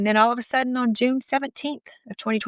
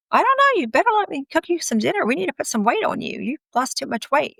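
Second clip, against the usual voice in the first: high-pitched, singsong coaxing; coaxing concern; 8 semitones higher than usual. Retold or imitated. Wife urging thin husband to eat dinner, coaxing concern. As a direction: start very high and urge gently, retold.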